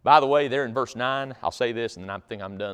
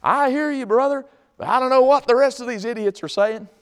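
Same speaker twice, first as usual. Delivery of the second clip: high, loud distant holler; calling from afar; 12 semitones higher, 7 dB louder than usual. Acted. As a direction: yell back across a long distance. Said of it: "way off over there in the distance".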